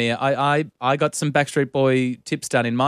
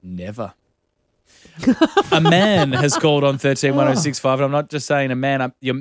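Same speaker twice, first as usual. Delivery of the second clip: ordinary talk, nothing marked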